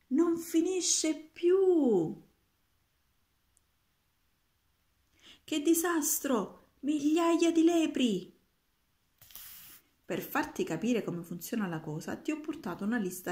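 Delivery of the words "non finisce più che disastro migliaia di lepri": high, loud and slow despairing wail; dismayed cry. Acted. Roberto to the magician about the hare flood, high dismayed wail. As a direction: drag out the horror.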